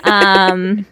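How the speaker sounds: normal talking voice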